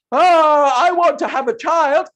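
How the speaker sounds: wrong voice for the wife; wrong wide mouth. Shown as a demonstration. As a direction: overblow the wife's wish. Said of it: "my mouth is too big for that".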